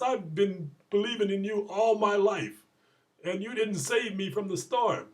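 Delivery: high, loud mock complaint; retold indignation. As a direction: protest to God as the wronged believer. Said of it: "now he's really mad".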